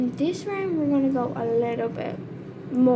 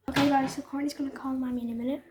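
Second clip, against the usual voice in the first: ordinary delivery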